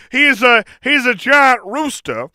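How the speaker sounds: usual speaking voice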